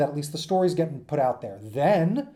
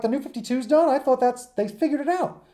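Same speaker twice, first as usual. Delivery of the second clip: surprised disbelief; 8 semitones higher than usual. Retold or imitated. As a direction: act stunned that the series is already over. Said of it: "I'm like".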